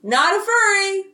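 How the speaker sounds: high-pitched insistent call; calling after her. A narrator's reading. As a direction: call after someone walking away. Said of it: "Jake calls at her".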